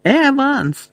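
Еванс very high, long and pleading; desperate call. A narrator's reading. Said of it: "крикнув їй услід Джеймс".

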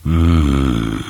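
drawn-out closed-mouth hum; mumbled grunt. A narrator's reading. Paszczak reacting to Chomek's plan, wordless mumble. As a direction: mumble a long, noncommittal mm. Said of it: "wymamrotał Paszczak".

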